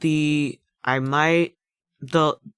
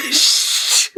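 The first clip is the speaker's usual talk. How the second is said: shushing; 9 dB louder than usual. Real, not acted. Hushing self.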